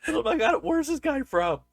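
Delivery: high, bewildered outburst; a baffled viewer at the odd accent; mock bewildered.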